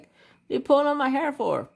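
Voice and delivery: high voice of the irritated aunt; annoyed aunt. Retold or imitated. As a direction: play the aunt snapping back at the kid. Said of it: "She's like".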